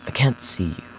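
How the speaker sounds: lightly voiced stranger straining to see; calculated man, strained. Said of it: "He spoke in a calm, calculated tone".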